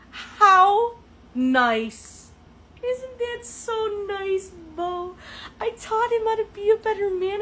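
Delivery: high, sing-song sarcastic voice; mock sweetness. Ridiculing the date to her friend Bo in a high, mock-sweet voice. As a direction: put on a sugary fake-pleased voice.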